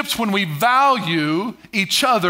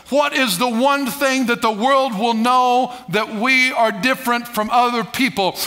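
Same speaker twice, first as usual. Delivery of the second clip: ordinary talk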